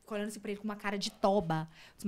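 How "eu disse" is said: usual speaking voice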